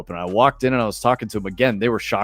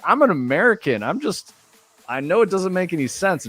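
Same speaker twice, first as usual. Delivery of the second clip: tag; 8 semitones higher than usual. Retold or imitated. Retold protest to angry barmaid.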